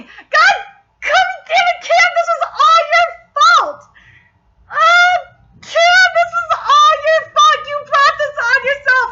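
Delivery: high-pitched exasperated shout; scolding squeal at full volume; mad outburst. Real, not acted. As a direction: yell at the character, loud and high. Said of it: "I was so mad at Cam".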